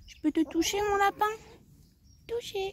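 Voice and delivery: high coo to a pet, fading to a near whisper; high pet voice trailing soft. Real, not acted. Cooing to a pet before stroking.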